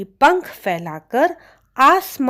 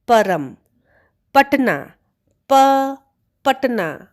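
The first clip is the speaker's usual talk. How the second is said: clear, deliberate teacher drill, syllable split off; repeat-after-me drill; word drill; 3 semitones higher than usual. Shown as a demonstration. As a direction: say each word, isolate प, say it again for the class. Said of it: "चलिए उन शब्दों को दोहराते हैं".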